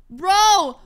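startled outburst. Real, not acted. Startled by the violent game animation.

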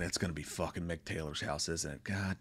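sinking to a hushed whisper; inner dread. Retold or imitated. Viewer dreading the killer's house, fading to a whisper. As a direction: mutter your fear under your breath. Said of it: "And you're thinking".

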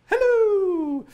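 high, loud cartoonish hello; silly voice. Coder voicing his web page's greeting in a silly high, loud voice. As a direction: pipe it up high and loud like a cheery web page. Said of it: "I'm speaking in that voice".

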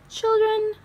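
high, loud call; calling the class. A narrator's reading. Teacher calls out to the children, high and loud.

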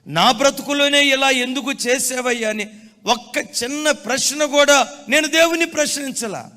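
loud, high pulpit proclamation; fervent sermon voice; full-force preaching. Acted. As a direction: preach it out to the congregation.

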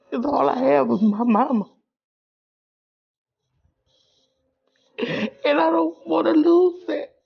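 tearful and pleading, barely holding on; crying. Real, not acted. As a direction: voice breaking high as she fights tears. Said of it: "I'm sorry for crying like this".

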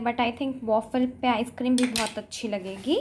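ordinary talk, nothing marked

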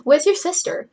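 high, loud demanding question; calling out. Acted. Cleo asks Claude about his sister, calling out. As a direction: call the question across the room.

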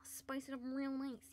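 soft whispered cooking line. Acted. Whispering a cooking step to chat. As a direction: whisper it like a secret.

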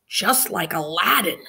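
stage whisper, breathy but carrying; loud whisper. A narrator's reading. Boy interrupting the story, loud whisper. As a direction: whisper it so the class hears. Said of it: "said in a loud whisper".

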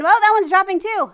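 loud, high-pitched burst of alarm; startled. Real, not acted. Player dodges another falling trap, startled exclamation. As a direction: exclaim it as it falls.

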